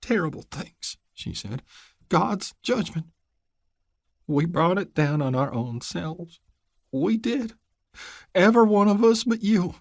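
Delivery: high, hushed, worn mountain woman; grieving old mother. Acted. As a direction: murmur like a broken old mother.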